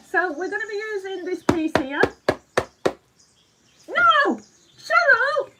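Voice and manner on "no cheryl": high, loud mock-outraged cry; playful shout. Real, not acted. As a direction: yell it at a cheeky friend. Viewer Cheryl teases her on stream.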